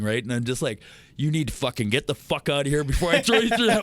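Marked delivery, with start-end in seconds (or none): 1.2-3.8 high, loud mock-furious threat; irritated threat. Old bully telling off his sober school friend, loud and furious. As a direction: spit it out like you've finally snapped. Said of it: "I could just feel my fucking irritation rising".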